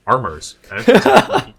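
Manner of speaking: mock West Country farmer twang, breaking into a laugh; laughing mock-farmer West Country accent. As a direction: put on a rustic West Country burr. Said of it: "down where I live, it's like".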